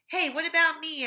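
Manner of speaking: put-on high, loud kid complaint; whiny protest. A narrator's reading. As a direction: protest being left out. Terrence protests.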